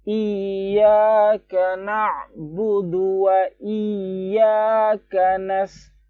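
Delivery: slow, high chanted tilawah; Quran recitation. Shown as a demonstration. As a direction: recite the verse slowly in full. (tilawah pelan dan tinggi)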